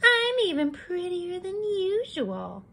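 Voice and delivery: put-on preen like a vain little girl. Red admiring her cape, high and sing-song. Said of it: "she said".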